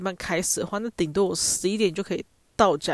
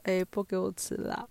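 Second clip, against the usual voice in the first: pleading pitifully; 7 dB quieter than usual. Retold or imitated. Begging her sister to peel an orange, soft pitiful plea. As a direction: beg meekly like a little sister wanting help. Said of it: "你必须要低声下气，装可怜".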